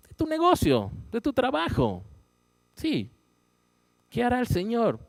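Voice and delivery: mucho más agudo y fuerte, predicando; proclámalo con fervor a la congregación; fervent preaching. Acted. (predicador prometiendo prosperidad al negocio, con fervor)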